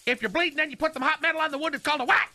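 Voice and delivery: quiz voice imitation. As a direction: rattle off the questions high and eager. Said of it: "I can't do the voice".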